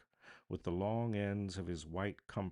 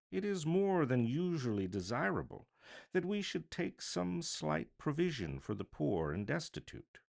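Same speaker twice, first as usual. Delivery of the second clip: lightly voiced earnest gentleman, higher; a polite petitioner pleading for the poor, pitched up; charity gentleman; 10 semitones higher, 3 dB louder than usual. A narrator's reading.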